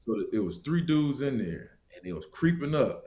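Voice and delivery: usual speaking voice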